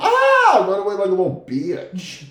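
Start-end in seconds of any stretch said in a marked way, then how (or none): 0.0-0.6 sudden high yelp of panic; scared scream. Retold or imitated. Big goofy guy yelping at a gun, a high panicked yelp. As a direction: yelp and bolt like a scared kid. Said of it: "I'm like".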